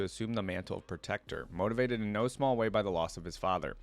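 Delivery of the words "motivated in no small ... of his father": normal talking voice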